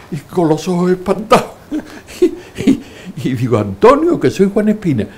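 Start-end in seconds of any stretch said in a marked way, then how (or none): 3.6-5.1 louder and much higher, calling after him. Retold or imitated. Calling after the frightened priest, loud and high. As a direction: shout his name to stop him.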